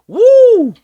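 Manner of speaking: high, loud whoop; a big celebratory holler; cheering woo. Real, not acted. Cheering a good stat.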